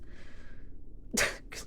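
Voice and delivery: soft breathy snicker; a muffled mischievous laugh; quiet laugh. Acted. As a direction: laugh at their pain. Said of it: "Quit laughing".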